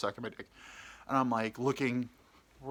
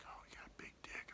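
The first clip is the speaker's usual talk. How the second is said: hushed, whispered mock seduction; barely audible put-on whisper; whispered dirty talk; 20 dB quieter than usual.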